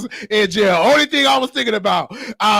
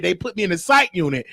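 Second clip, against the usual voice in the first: ordinary talk, nothing marked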